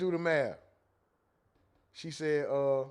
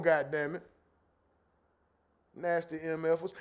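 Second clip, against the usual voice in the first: ordinary delivery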